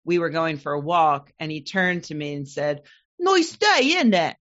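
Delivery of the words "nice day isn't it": imitated London accent, high and louder. Woman quoting her husband on a walk, London accent. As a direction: mimic a Londoner's chirpy small talk. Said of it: "in London".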